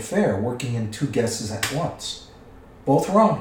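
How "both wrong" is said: higher, louder exclamation; relieved cry. A narrator's reading. Bilbo very much relieved at winning, crying out. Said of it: "cried Bilbo".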